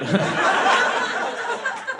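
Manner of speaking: squeaky burst of laughter; high laugh. Real, not acted. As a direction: laugh at your own line. Laughs at own joke.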